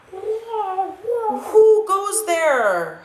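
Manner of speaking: high, stretched-out holler into space. Acted. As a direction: call out across the void, slow and high. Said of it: "Hollered Scipito".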